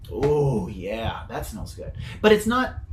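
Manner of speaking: normal talking voice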